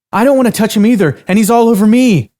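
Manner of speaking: high, loud, fast snapping outburst; losing her cool. Acted. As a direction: snap at your friend in frustration. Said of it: "Cassie suddenly lost her cool".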